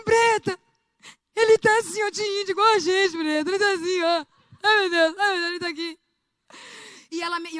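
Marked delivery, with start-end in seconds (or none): 0.0-6.0 panicked. Retold or imitated. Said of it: "eu entrei em pânico".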